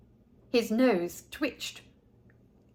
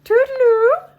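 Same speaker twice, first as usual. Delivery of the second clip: much higher, much louder singsong goodbye; a chirpy Missus Peacock sing-song farewell; high fluttery lady; 11 dB louder than usual. A narrator's reading. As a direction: trill it like a fussy, delighted lady.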